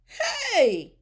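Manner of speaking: high, loud scolding of an intruder; yelling lunch lady. A narrator's reading. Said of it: "one of the lunch ladies yelled".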